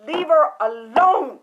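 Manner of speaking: loud, urgent command; Nipsey shouting her down; shouted warning. Retold or imitated. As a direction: yell at her to stop. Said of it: "he said".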